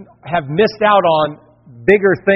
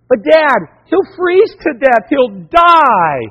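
put-on high, louder distressed child; pleading daughter; 6 semitones higher, 3 dB louder, slower than usual. Daughter begs dad on the phone, high and upset. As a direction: plead as if the cat will die. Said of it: "daughter pleading on phone".

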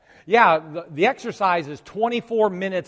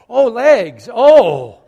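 loud, higher groan of aching legs; pained groan at legs; 6 semitones higher, 12 dB louder, much slower than usual. Retold or imitated. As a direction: moan at sore legs hitting the floor. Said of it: "I went".

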